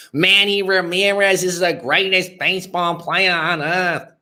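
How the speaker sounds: high, hammed-up Boston accent. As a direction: do the Boston sports fan again in a thick accent. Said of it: "That's the accent I'm thinking of".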